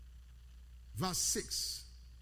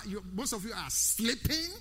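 ordinary talk

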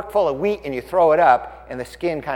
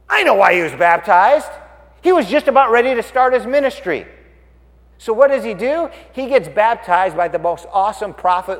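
high, loud declaration; excited realization; 7 semitones higher, 8 dB louder than usual. Real, not acted. Preacher revealing why Jesus was baptized, excited and loud. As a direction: announce the answer as a discovery. Preacher announces the answer he found.

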